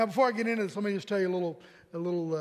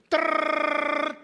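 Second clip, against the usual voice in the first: high rattling trill like a jackhammer; woodpecker impression; 7 semitones higher, 6 dB louder than usual. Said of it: "After I do my woodpecker impression".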